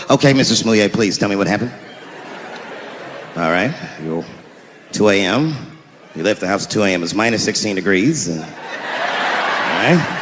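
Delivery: put-on a skeptical old cop taking a report; weary veteran cop. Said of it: "if you was a police veteran taking this kid's police report".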